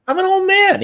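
imitating an old man pleading with a burglar; old man plea. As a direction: play up being a helpless old man. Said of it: "He's like".

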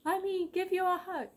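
high, insistent mock plea; clingy friend. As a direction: squeak out a stubborn hug request. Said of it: "And I'll be like".

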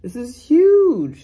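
loud, overwhelmed, stretching BIG and HUGE; emotional. Real, not acted. Buyer telling viewers what this means, emotional. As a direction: swell with feeling, drawing out key words. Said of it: "it just makes me emotional".

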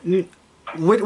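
usual speaking voice

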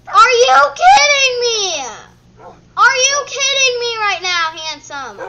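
loud, high cry of disbelief; exasperated shout. Real, not acted. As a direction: yell it in exasperation. Exasperated outburst at the game.

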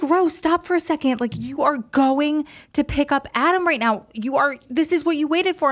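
high, loud, emphatic excitement; retold elation. Friend to stunned partner about the pickup, loudly thrilled. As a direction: drive the good news home, high and loud.

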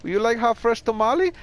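imitating a street tamale seller with a Mexican accent; tamale lady voice. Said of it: "she's all like".

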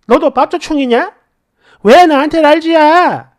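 acted indignant wife firing back questions; angry outburst. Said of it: "분노 조절 장애가 또 터지는 거야".

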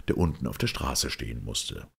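ordinary delivery, nothing marked

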